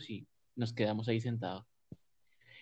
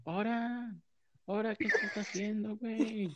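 higher, lightly voiced kid's yell; mock child's yell; 10 semitones higher than usual. Friend asks, he redoes his cousin's shout in a higher voice. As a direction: call it out like a tattling little cousin. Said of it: "cómo gritó".

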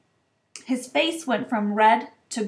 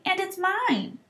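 high-pitched indignant protest; boy's angry insistence; furiously; 9 semitones higher, slower than usual. A narrator's reading. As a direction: stake your claim, voice rising high. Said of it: "said Harry furiously".

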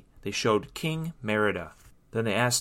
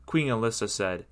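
ordinary delivery

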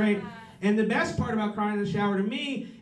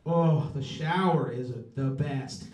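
ordinary delivery

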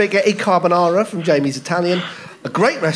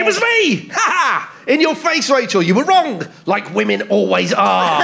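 much higher, crowing victory cry; gloating triumph; 4 semitones higher than usual. Acted. Sexist suspect gloating at the contestant, high crowing triumph. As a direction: crow it out like you just won. Gloating misogynist sketch character.

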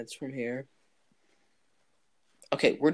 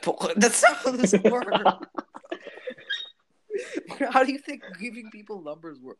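laughing; 6 semitones higher, 6 dB louder than usual. Real, not acted. Laughing at date's flirt line.

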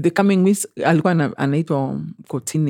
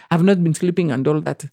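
ordinary delivery

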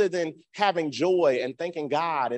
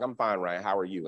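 low, understated answer; curt reply; 6 semitones lower, 3 dB quieter than usual. Retold or imitated. Professor answering a student, low and cool. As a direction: imitate a cool, unenthused reply. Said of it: "she was just like".

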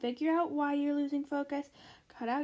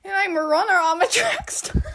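high, loud hammed-up Texan accent; 6 semitones higher, 9 dB louder than usual. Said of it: "Texan accent".

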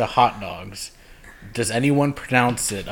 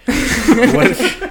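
laughing; 14 semitones higher, 9 dB louder, much slower than usual. Real, not acted. Laughing mid-question.

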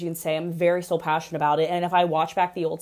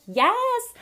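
high, bright "Yes!" cheer; delighted cheer. Retold or imitated. Cheering as Angelina moons people, high cheer. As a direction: squeal it like a thrilled fan. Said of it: "I'm like".

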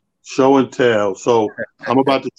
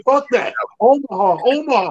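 quarterback calling cadence; 10 semitones higher than usual. Retold or imitated. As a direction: bark out Omaha like a quarterback. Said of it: "Peyton Manning say".

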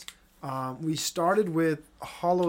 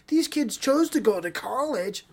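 mocking quote; 6 semitones higher than usual. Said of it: "and people were saying".

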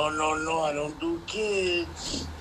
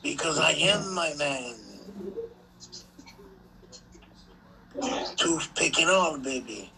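Razor Ramon voice; 2 semitones higher than usual. Pretend. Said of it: "why you trying to sound like Razor Ramon?".

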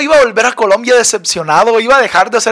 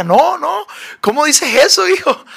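much higher-pitched imitation of a dismayed mom; mock motherly alarm, pitched way up; shocked mother; 9 semitones higher than usual. Mother protesting to her son's wish to try jail, shocked.

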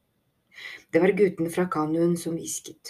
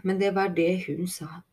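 ordinary delivery

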